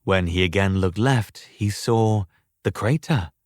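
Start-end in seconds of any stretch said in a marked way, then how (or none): none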